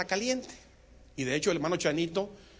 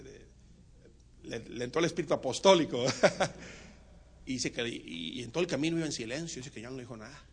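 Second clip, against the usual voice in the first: ordinary delivery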